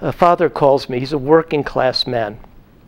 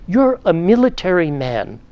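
stern scolding; 3 semitones higher than usual. Retold or imitated. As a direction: scold a hypocrite father with cold authority. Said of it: "I say to him sternly".

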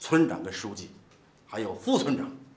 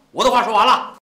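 ordinary talk, nothing marked

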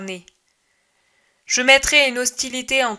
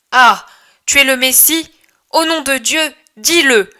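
loud challenge; 4 semitones higher, 6 dB louder than usual. Acted. Crowd challenging Jesus.

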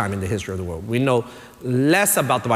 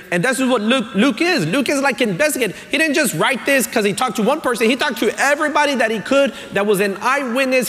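raised, driving sermon voice; preaching at full force; 10 semitones higher than usual. Acted. Pastor preaching to the church, raised and driving. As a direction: preach it out, building the point.

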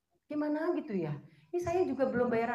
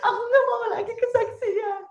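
loud and high, imitating a shaky plea; retold frightened plea; 11 semitones higher, 7 dB louder than usual. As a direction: repeat the scared refusal to God, higher and louder. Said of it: "Gemeteran saya".

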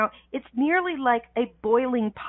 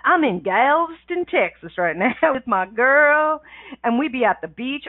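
Texas drawl. Shown as a demonstration. Telling listeners she's broadcasting from Galveston, in a hammed-up Texas drawl. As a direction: lean into a playful Texas drawl with a laugh. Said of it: "I need to put a drawl on that".

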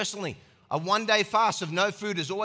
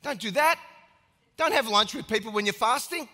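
loud warning; 4 semitones higher, 4 dB louder than usual. Real, not acted.

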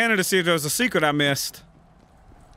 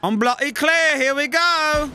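put-on echoing the game's British accent, pitched up; parroting the NPC in a cheeky British accent, louder; mock British accent; 8 semitones higher, 3 dB louder than usual. Gamer answers the game character, mock British accent. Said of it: "you'll wanna stand bloody clear".

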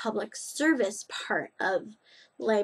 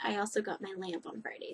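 usual speaking voice

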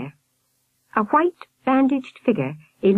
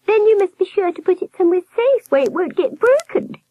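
very high warbling falsetto; advising nurse; 13 semitones higher, 4 dB louder than usual. A narrator's reading. Nurse advising Albert, high-pitched warble. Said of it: "the high-pitched voice".

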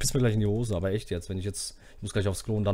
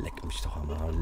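muttered curse; 8 semitones lower, 9 dB quieter than usual. Real, not acted.

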